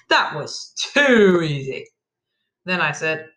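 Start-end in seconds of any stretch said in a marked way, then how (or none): 0.1-1.9 slow, drawn-out villain gloat; gloating bandit. A narrator's reading. Bandit to fallen victim, slow gloating. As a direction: savour it slowly as the victor.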